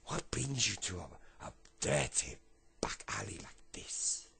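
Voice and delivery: slow French drawl fading into breath; rough French accent. Acted. Homeless man questions lad in the alley, rough French accent. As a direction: draw it out in amateur French accent. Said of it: "I can't do a brilliant French accent".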